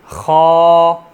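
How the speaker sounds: खा thick and full from the throat; खा with a thick, raspy throat sound; heavy kha. Shown as a demonstration. Teacher demonstrating a heavy letter to students. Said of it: "इसको मैंने थोड़ा मोटा करके पढ़ा है".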